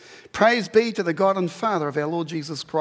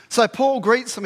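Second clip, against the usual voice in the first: normal talking voice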